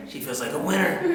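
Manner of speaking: low, soft mock-defeated voice; small deflated kid answer; dejected kid. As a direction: grudgingly admit it, low and quiet. Voices his defeated younger self.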